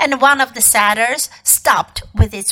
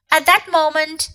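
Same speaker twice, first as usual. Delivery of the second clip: usual speaking voice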